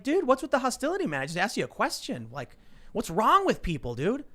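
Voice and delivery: high-pitched baffled complaint; retold bewildered objection to being snapped at; incredulous protest. Pundit to the pollster over his hostility, incredulous.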